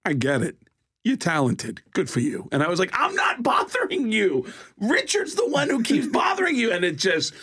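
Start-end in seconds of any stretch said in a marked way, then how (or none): 0.0-2.5 tag. Retold or imitated. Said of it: "Walken cuts me off and just goes". 2.9-6.7 high, strained comic protest; exasperated, high-pitched objection. Retold or imitated. Protests to Walken, still imitating.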